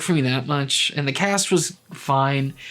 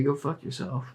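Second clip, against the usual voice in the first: muttered under breath; 8 dB quieter than usual. Shown as a demonstration. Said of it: "that's my phrase of the day".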